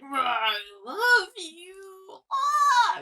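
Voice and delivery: slow, high, shouted wail; wailing I love you ending in a yell; freaky cry. Retold or imitated. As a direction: stretch it into a freaky shriek. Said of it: "making freaky noises".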